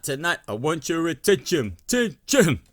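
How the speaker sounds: loud, high parade-ground call. Retold or imitated. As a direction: shout it like a drill sergeant, rising to the last word. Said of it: "when [name] shouts".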